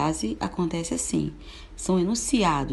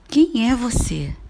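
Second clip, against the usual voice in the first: louder, higher, slower demanding question; emphatic question; 5 semitones higher, 6 dB louder than usual. Shown as a demonstration. Teacher repeating the question, louder and demanding. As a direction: demand it, stressing Quem. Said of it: "As frases interrogativas".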